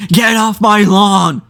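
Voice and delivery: slow, high shouted get off my lawn; grumpy old man. Retold or imitated. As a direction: yell it like a cranky old neighbor. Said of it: "you know what they say".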